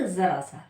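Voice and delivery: जरा सा with z in place of j; Urdu z sound. Shown as a demonstration. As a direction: buzz the first sound of जरा सा as z. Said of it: "क्या बोलेंगे?".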